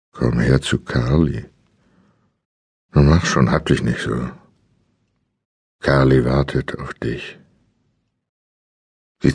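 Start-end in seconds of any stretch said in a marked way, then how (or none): 0.1-7.4 deep pressed voice. Acted. Kidnapper coaxing his captive, low pressed creepy voice. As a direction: talk with chin pressed to chest. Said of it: "Sie klang tief und gepresst".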